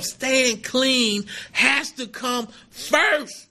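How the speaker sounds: "staying clean has to come first" spelled out word by word, very slowly; slow and deliberate. Shown as a demonstration. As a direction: lay each word down slowly like a rule. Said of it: "It says that in the first step".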